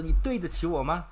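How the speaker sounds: high, loud accusing question; mock argument. Teacher acting out an argument, indignant questioning. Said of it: "it's for argument".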